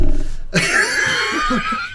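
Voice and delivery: very high burst of laughter; real high-pitched cackling laugh; delayed laugh. Real, not acted. Gets the movie quote late.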